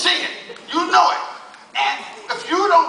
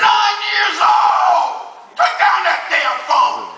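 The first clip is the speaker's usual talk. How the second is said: outraged shout; 4 semitones higher, much slower than usual. Real, not acted. Comic outraged at kid.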